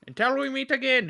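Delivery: high, nasal cartoon villain voice; shrill sneering cartoon villain; classic cartoon Skeletor. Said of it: "the very high-pitched and nasally voice".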